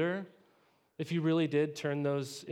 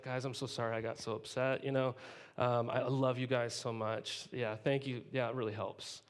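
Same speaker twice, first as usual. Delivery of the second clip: lower, softer, sugary forgiveness; mock gracious Paul; 6 semitones lower, 5 dB quieter than usual. Paul accepting their apology, mock sweet and soft. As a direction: purr a fake-humble thank you. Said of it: "Paul comes back with".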